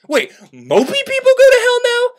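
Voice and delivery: high, laughing outrage at the idea; cracking into a disbelieving laugh; incredulous laugh. Real, not acted. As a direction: squeak the question way up, laughing in disbelief. Outraged aside on Dante's story.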